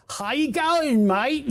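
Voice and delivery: hammed-up, high-pitched Aussie greeting; bad Australian accent. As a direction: do the worst Australian accent you can, to embarrass. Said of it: "Doing the shittiest Australian accent I can".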